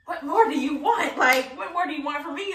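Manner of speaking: laughing mock exasperation. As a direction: throw up your hands, laughing.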